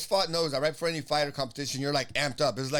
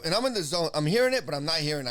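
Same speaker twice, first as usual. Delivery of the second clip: normal talking voice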